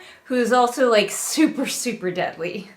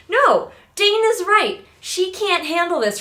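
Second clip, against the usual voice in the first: emphatic; 10 semitones higher, 4 dB louder than usual. Real, not acted. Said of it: "emphatic rant".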